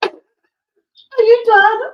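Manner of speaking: drawn-out, high, loud mock impatience; teasing. Real, not acted. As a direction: hurry up the joking husband. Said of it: "teasing husband".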